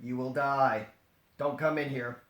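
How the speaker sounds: ordinary talk, nothing marked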